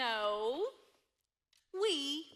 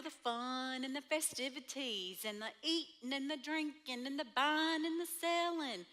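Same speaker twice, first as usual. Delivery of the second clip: ordinary talk, nothing marked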